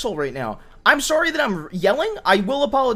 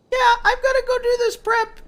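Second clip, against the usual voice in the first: much higher mock voice of his wife; mimicking wife; 11 semitones higher than usual. Husband mimics his wife's excuse in a much higher voice. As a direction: pitch it up to mimic her. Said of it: "and you're like".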